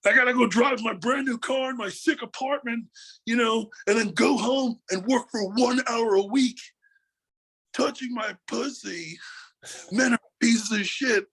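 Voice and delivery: hammed-up mock whine; mocking a sex worker. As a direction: sneer through a spoiled rant.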